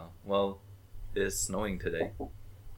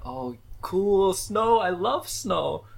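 mock delight; 8 semitones higher, much slower than usual. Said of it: "I'm like".

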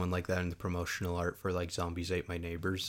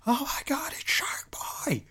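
very high squealed fangirl outburst, last words drawn out; excited mock yell; 4 dB louder, slower than usual. Retelling theater outburst at seeing actor, excited mock yell. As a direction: imitate squealing at a surprise sighting. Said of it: "we'd just yell out".

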